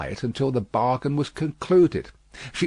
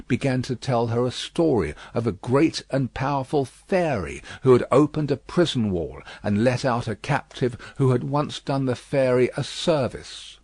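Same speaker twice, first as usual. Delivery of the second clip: ordinary delivery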